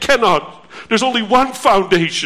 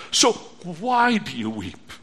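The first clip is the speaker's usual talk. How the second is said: ordinary talk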